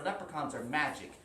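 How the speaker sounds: ordinary delivery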